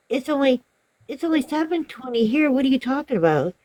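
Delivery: high-pitched, disbelieving mimicry; imitated arguing customer. Customer disputes the time with pizza caller, arguing in disbelief. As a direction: push back like a baffled customer. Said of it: "she kept arguing with him".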